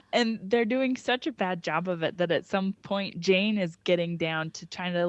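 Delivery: normal talking voice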